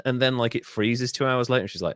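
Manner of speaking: ordinary talk, nothing marked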